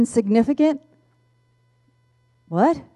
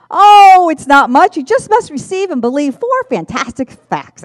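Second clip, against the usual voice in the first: high, loud eager pitch; excited; 7 semitones higher, 10 dB louder than usual. Retold or imitated. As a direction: burst out eager to share. John excited about facts.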